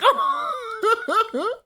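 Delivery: shrill burst of laughter; high laugh. Real, not acted. Vlogger laughing at own joke, high laugh. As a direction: cackle high and loud.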